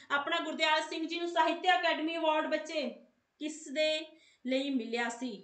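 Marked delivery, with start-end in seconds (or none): none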